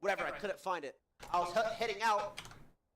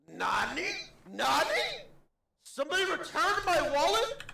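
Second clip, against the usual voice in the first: high, loud stunned "Nani?"; shocked disbelief; 10 semitones higher, 9 dB louder, slower than usual. Retold or imitated. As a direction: squeal it in total disbelief. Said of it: "I said".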